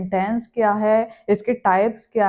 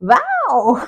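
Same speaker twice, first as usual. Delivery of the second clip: amazed wow; 3 semitones higher, 3 dB louder, much slower than usual. Shown as a demonstration. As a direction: act out being wowed, stretching each wow. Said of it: "आश्चर्यचकित भी तो होते हैं".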